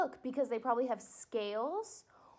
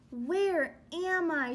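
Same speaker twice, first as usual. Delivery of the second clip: slow, bewildered little voice; lost up high, unsure; lost baby bird; 3 semitones higher, 5 dB louder, slower than usual. Acted. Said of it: "said the baby bird".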